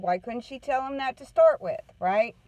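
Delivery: ordinary talk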